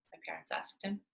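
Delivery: ordinary delivery, nothing marked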